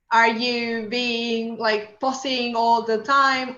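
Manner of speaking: slow, halting, pausing delivery; slow pausing demo. Shown as a demonstration. Teacher showing slow pausing pace. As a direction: drag it out slowly with pauses. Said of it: "quickly or slowly".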